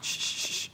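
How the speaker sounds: soft unvoiced shushing; hushing noises. Real, not acted. Host works macaroni into the pot, soft shushing. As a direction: make little "shh" sounds under the breath.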